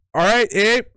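high, loud hammed-up Canadian accent. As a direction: play a cheery Canadian bloke. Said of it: "with my Canadian voice the whole time".

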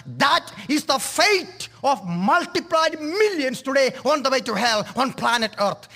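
normal talking voice

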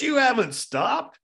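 high, loud, slow disbelief; incredulous challenge. Retold or imitated. Bandit disputing the monk's claim to have stopped, incredulous.

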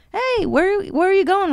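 put-on cheerful young helper calling out; kid voice.

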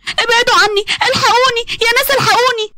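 high frightened cry; screaming for help. Acted. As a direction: call out to the neighbours in terror. Maryam calling for help.

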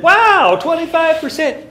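loud, high-pitched mock astonishment; acted amazement. Lecturer replaying his reply to the Scotsman, amazed. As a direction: exclaim it as if staggered.

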